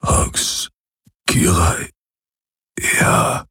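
faint murmur fading out. A narrator's reading. Wounded Hawks murmurs agreement, fading weaker. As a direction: let each word die away. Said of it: "murmelte er".

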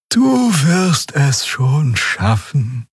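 langsam und höher, gelangweilt; schläfrig, langsam gedehnt; träge. A narrator's reading. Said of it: "sagte Xia träge". (Xia ermutigt träge, langsam gedehnt)

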